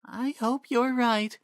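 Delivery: put-on a young noblewoman shrugging off pain; weary Miranda. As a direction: Miranda reassuring Impa after her fall, weary. Miranda voiced in story.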